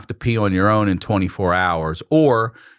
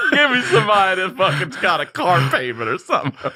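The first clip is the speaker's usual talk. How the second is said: laughing; 13 semitones higher than usual. Real, not acted. Telling his friend about demanding a nurse who needs the hours, laughing shout. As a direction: laugh through the punchline, almost yelling.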